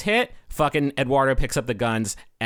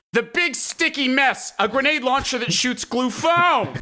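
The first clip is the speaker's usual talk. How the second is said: high, strained imitation of a jittery salesman; mock nervous pitch, much higher; nervous vendor; 11 semitones higher than usual. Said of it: "a man who is extremely nervous".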